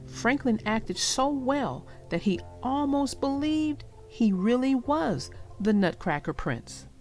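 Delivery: plain reading voice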